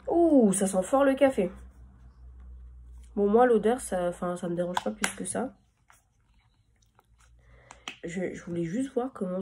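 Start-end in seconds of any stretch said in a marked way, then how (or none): none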